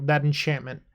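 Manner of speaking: ordinary talk, nothing marked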